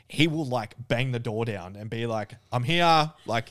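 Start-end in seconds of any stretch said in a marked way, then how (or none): 2.5-3.1 called out high and loud, arriving; loud announcement. Retold or imitated. Mate announcing himself at a stranger's door, loudly. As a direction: bellow it like bursting through a front door.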